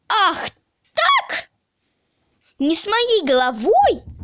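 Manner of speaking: high, offended exclamation; indignant outcry. A narrator's reading. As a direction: flare up, pitch leaping high. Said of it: "закричал Незнайка". (обиженный возмущённый крик)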